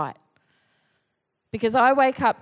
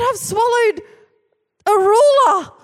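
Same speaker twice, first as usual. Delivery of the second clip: high, loud frantic confession; panicked cry; 11 semitones higher, 7 dB louder than usual. Retold or imitated. Sleepwalking husband tells wife, high loud panic. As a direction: blurt it out in terror.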